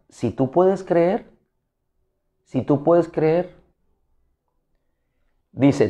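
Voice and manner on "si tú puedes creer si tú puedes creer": slow read with a rising end on "creer"; a doubtful question, stretched out and rising. Shown as a demonstration. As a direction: ask "¿Si tú puedes creer?" slowly, lifting at the end. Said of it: "O en pregunta".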